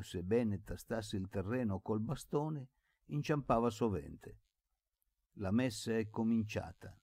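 usual speaking voice

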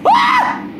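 high startled scream. Real, not acted. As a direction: scream as you fall. Falling in game.

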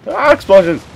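thrilled shout at the blasts; excited. Real, not acted. Thrilled by game explosions.

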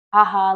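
loud, disbelieving "haha"; mock laugh. Daughter refusing news of dad's death, loud mock laugh.

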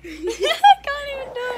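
high, loud, giggling through the words; too tickled to get it out; laughing. Real, not acted. Girl failing at the acting exercise, laughing. Cracking up trying a staring exercise.

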